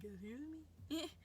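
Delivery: high, drawn-out disbelief; incredulous reaction. Retold or imitated. Retelling her shocked reply to officers.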